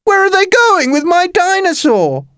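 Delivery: put-on very high, distressed boy's cry; shouted. A narrator's reading. Jake as scientists take his dinosaur, high alarmed shout. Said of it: "shouted Jake".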